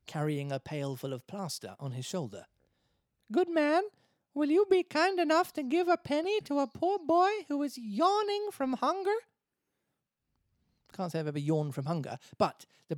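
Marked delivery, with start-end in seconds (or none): 3.3-9.2 put-on very high, loud puppet-boy voice; begging Pinocchio. Pinocchio begging a bricklayer for a penny, high pleading voice. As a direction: plead like a hungry little puppet.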